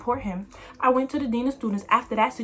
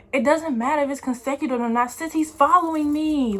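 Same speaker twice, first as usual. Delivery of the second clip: high, loud indignant retort; arguing with the dean, higher and louder, indignant; mad retort; 5 semitones higher, 4 dB louder than usual. Retold or imitated. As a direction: push back hard on the dean. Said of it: "I was so mad".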